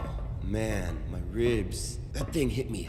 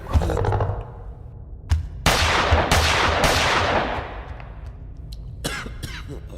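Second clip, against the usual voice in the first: long, loud coughing; coughing fit; 7 dB louder than usual. Acted. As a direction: cough hard after an attack. Hurt survivor after the attack.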